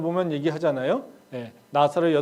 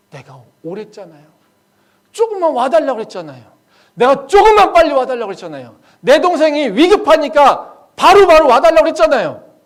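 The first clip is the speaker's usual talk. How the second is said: Mary's reproach; 10 semitones higher, 18 dB louder than usual. Acted. Mary reproaching Jesus for coming late, building to shouted complaint. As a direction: escalate into loud, pleading accusation. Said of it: "지금 마리아가 그 얘기를 하고 있는".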